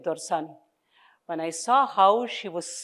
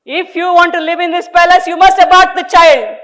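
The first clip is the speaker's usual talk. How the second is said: squeaky demand; 10 semitones higher, 12 dB louder than usual. A narrator's reading. Husband's demand, voiced high.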